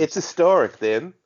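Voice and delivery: put-on high, loud British accent; hammed-up British announcer. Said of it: "British accent. I apologize".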